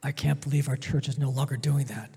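low, quick complaining mutter; mock grumbler. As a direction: imitate a grumbling churchgoer under your breath. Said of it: "it goes something like this".

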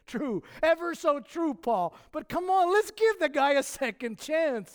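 high, coaxing, pleading encourager voice; imagined Barnabas pleading with Paul for John Mark. Retold or imitated. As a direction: coax Paul gently but high and bright. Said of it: "I could only imagine Barnabas, ever the encourager".

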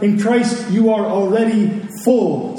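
ordinary talk

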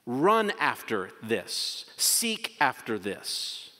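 slow, deliberate exhortation; slow preacher's charge; slow command. Shown as a demonstration. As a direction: lay each word down slowly. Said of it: "slow exhortation".

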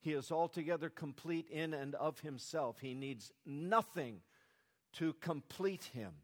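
ordinary talk

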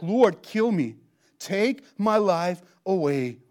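slow, loud plea to be allowed to die; despairing plea. Retold or imitated. Jonah begging God to take his life, slow loud plea. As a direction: beg the Lord to end it. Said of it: "And now he's like".

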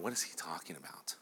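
confused hushed whisper; a disciple muttering his confusion under his breath. Acted. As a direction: whisper it like a secret doubt among friends. Disciple's confused inner question.

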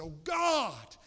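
imitating an anguished, screamed prayer; high, loud cry of conviction; crying out to God. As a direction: cry out as the man did, in anguish.

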